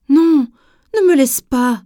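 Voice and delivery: loud, high desperate plea not to be left; pleading. A narrator's reading. Lion begging his friend not to leave him, loud and pleading. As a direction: beg her frantically to stay. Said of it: "supplie Léon".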